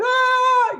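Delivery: high, loud scared yelp; mock scream of panic. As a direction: shriek in playful fright. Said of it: "They're like".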